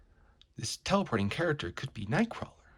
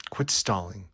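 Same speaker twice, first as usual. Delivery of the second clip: normal talking voice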